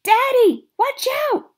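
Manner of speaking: high, loud scream of warning. A narrator's reading. Girl screams a warning to her father, loud and high. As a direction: yell to save him from the charging dog. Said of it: "I screamed".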